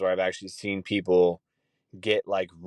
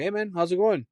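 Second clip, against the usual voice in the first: friendly manager; 10 semitones higher than usual. Retold or imitated.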